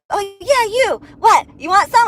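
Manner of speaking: high, loud mocking challenge; mock taunt. Other driver challenging her from the car, loud mocking taunt. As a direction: egg her on like a bully.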